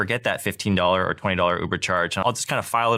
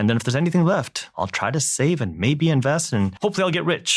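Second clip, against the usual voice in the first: mock careless spender; 7 semitones higher than usual. Host mocking Americans who spend everything, hammed-up and higher. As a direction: poke fun at the broke spender's airy whine.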